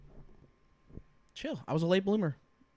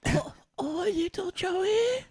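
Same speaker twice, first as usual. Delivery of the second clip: put-on slow, high old granny call; high grandma voice; 10 semitones higher, much slower than usual. Shown as a demonstration. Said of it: "Do a grandma".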